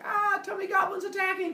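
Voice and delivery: high, loud mock panic; freaking out. Kronos is attacked by his children, hammed-up panic. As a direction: squeal it like a comic alarm. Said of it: "Kronos freaks out".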